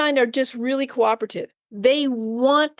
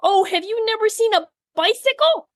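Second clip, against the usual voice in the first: jollying the puppy; 8 semitones higher than usual. Retold or imitated. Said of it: "I love the".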